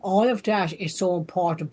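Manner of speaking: ordinary talk, nothing marked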